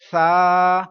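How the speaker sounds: سا said loud with no hiss; teacher shows سا without a whistle. Shown as a demonstration. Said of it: "پڑھیں گے اس حرف کو؟".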